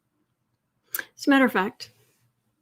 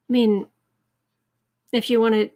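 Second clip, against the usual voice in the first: ordinary talk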